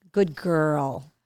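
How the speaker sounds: low, soft praise for the dog; gentle and pleased with the dog; soft praise to a pet; warm dog praise. Real, not acted.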